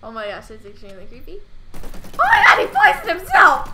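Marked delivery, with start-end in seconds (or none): 1.7-3.7 much higher, loud shocked cry; shocked shout. Real, not acted. Seeing a game character poison himself, shocked shout. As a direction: yell it out in disbelief.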